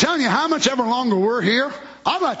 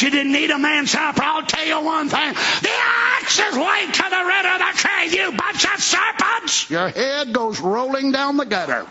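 high, drawn-out hellfire preaching; preacher's high, ringing cry; fiery preaching; 7 semitones higher than usual. Acted. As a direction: thunder a warning at sinners. Preaches at full force.

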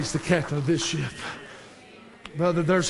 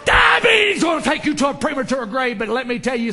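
high shouted attack on the first word; full-force preaching; 6 semitones higher, 6 dB louder than usual. Real, not acted. Preacher calling out diabetes, loud shout. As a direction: burst out loud, then ease slightly.

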